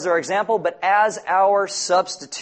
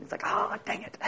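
soft, breathless mock grumble; a quiet joking aside under the breath; muttered aside; 9 dB quieter than usual. Real, not acted. Joking about someone closing Bible.

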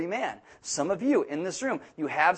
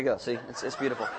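laughing; 6 semitones lower, 5 dB quieter than usual. Real, not acted. Laughing at his own story.

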